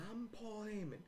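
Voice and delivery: high-pitched mock victory cry; Paul Heyman. Champion holding up the belt, high mock boast. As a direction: announce yourself in a hammed-up high voice. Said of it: "and he goes".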